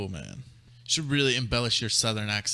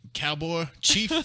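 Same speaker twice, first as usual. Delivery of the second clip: mock cowboy call; 6 semitones higher, 4 dB louder, slower than usual. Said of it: "you gotta".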